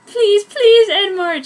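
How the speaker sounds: high, loud desperate begging; pleading cry. Acted. Girl begs Edward to take her to homecoming, loud pleading cry. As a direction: beg him at the top of your voice. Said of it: "she cried".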